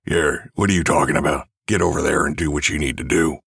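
quick, rough throaty character voice; throat-straining character. Shown as a demonstration. Character orders someone over there, quick throaty voice. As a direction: push it rough from the throat, briskly. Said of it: "not easy to do with a cold and an already irritated".

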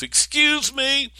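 put-on high, slow small child asking; a small boy politely interrupting; little boy voice. Little boy tugging on Daddy's coat.

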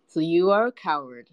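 sneering. A narrator's reading. Cat to the frightened hare, sneering. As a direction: sneer it in a storyteller's voice. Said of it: "sneered Paka".

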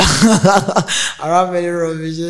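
I rap many rubbish drawn out slowly through a laugh; slow amused chuckling admission. Real, not acted. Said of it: "I rap many rubbish that year".

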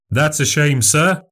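lightly voiced showman, a touch hurt; a little stung. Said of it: "sounding a little stung".

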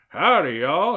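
loud, high, hearty welcome; exclaimed greeting. A narrator's reading. Uncle greets visitors at the farmhouse door, exclaiming. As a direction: call it out big and folksy. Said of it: "he exclaimed".

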